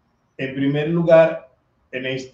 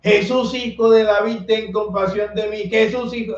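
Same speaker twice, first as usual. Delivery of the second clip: shouting; 9 semitones higher than usual. Retold or imitated. Blind beggar shouting to Jesus over the crowd, high and desperate. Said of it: "pues comenzó a gritar".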